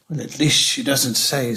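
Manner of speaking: ordinary delivery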